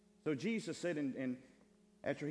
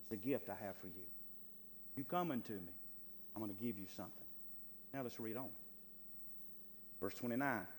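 ordinary delivery

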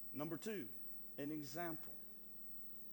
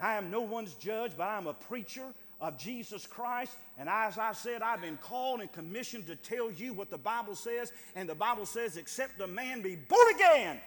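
loud, rising preacher's cry; full-force preaching; 3 semitones higher, 10 dB louder than usual. Acted. Preacher to the church, preaching at full force. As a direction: preach it out, building to a roar.

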